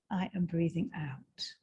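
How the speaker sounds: whispered out-breath mantra. Shown as a demonstration. Guide whispering the mantra on the exhale, hushed.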